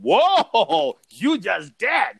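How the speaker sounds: loud, high "whoa", then drawn out; mock-astonished comeback, stretched for effect; shocked retort. Retelling his comeback to the man, shocked.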